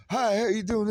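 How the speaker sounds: high, bright mock hello; high mock greeting of a crackhead; friendly greeter. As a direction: chirp it like a harmless stranger. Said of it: "when they go".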